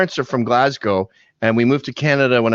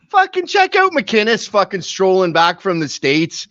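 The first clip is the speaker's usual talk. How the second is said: put-on thick Canadian accent, high and lilting; leprechaun-like Canadian friends; 5 semitones higher than usual. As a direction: imitate hometown buddies' singsong Canadian accent. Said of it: "all my friends sound like leprechauns".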